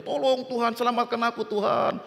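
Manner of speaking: high but held-back pleading call; mock cry for help. Flood victim prays to God, mock high pleading cry. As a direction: imitate a desperate plea without full volume. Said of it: "sampai teriak-teriak dia".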